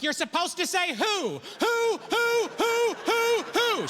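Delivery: high, loud exasperated hooting rant; losing it. Retold or imitated. Husband whose joke failed on his wife, losing it. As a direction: explode into frantic repeated hoots. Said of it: "I lost it".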